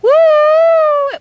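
long high whooo of a distant train; high, loud drawn-out train hoot; train whistle. Acted. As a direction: imitate a far-off locomotive whistle.

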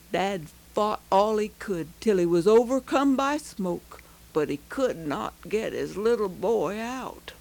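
ordinary delivery

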